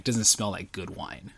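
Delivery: ordinary talk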